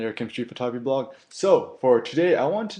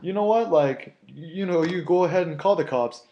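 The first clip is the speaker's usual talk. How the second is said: defiant dare; 6 semitones higher than usual. Retold or imitated. Telling the angry guy to go ahead and call the cops, higher. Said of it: "I'm like".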